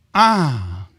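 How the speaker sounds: ordinary delivery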